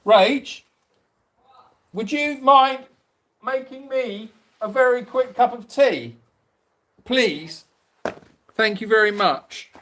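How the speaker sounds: butter her up for a cup of tea; sweet pleading request. Pretend.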